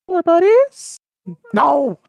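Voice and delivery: high, loud mock astonishment; hammed-up comic shock; incredulous. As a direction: shoot the pitch up in disbelief. Surprised question, then protest.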